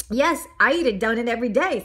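quick, slightly embarrassed confession; sheepish admission. Acted. Mister Bing admits eating donuts daily, mildly sheepish. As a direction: own up a little sheepishly. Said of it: "said Mister Bing sheepishly".

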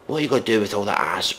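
usual speaking voice

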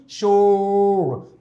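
शोर held very slowly, the श stretched out; long drawn sh. Shown as a demonstration. Teacher modelling the sound for the class. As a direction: hold the श of शोर for a long time. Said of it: "Please repeat after me".

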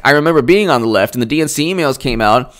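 ordinary talk, nothing marked

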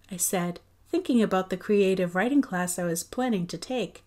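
ordinary delivery, nothing marked

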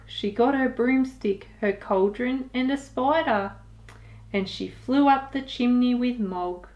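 plain reading voice

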